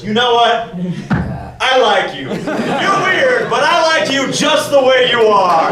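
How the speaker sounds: a hint of a delighted TV host; laughing Steve impression. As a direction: play the grinning host, winding up to a laughing shout. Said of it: "starts smiling and goes".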